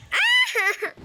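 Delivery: shrill-free sudden high yelp; a quick high yelp into laughter; high scream then laugh. Real, not acted.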